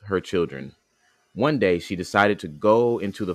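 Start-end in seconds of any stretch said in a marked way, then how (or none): none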